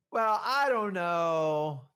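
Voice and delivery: hesitant seller. Acted. Seller stalling on the price.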